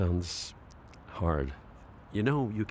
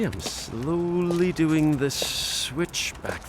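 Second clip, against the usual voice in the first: slow; 2 semitones higher, much slower than usual. Acted. Concentrating on steering the chair.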